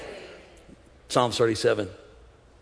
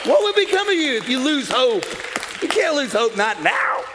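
full-force preaching; 9 semitones higher than usual. Acted. Preacher rallying church not to lose hope, full-force preaching. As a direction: push higher into a rousing declaration of faith.

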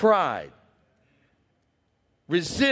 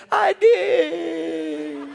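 proud frog; 8 semitones higher than usual. Retold or imitated. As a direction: blurt it out proudly. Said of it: "And the frog said".

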